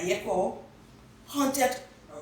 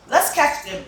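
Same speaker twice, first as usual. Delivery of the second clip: loud, raised eager voice; loud, high eager suggestion; 8 semitones higher, 8 dB louder than usual. Acted. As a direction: urge a friend on. Child wants to catch crickets.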